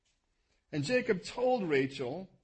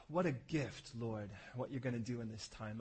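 soft, low praying voice after a sigh; hushed, reverent prayer; heartfelt prayer; 4 semitones lower, 5 dB quieter than usual. Real, not acted. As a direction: speak quietly to God, unhurried at first. Leads a prayer.

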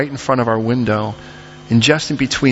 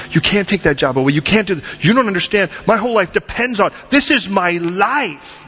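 pleading that builds higher and more insistent; desperate protest; 7 semitones higher than usual. Acted. Believer begging God not to take the job, rising desperate protest. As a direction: escalate the protest, stretching the final words.

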